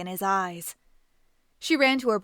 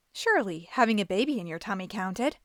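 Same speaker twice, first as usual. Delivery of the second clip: ordinary delivery, nothing marked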